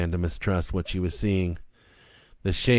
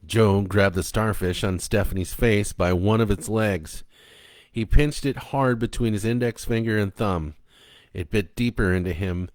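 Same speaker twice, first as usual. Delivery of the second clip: ordinary delivery, nothing marked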